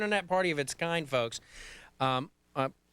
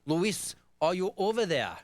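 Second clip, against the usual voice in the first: mock Brooklyn accent, dropped r's; hammy attempt at Brooklynese; 3 semitones higher, slower than usual. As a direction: put on a playful Brooklyn accent. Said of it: "that's my Brooklyn accent".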